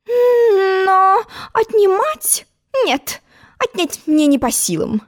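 slow, high, whining queen; whimpering old queen. Acted. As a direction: whine like a fretful old queen.

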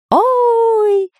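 very high squeal; straining cry. A narrator's reading. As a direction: shriek in fright. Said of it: "продолжал надрываться Дырка".

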